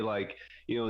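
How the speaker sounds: ordinary talk, nothing marked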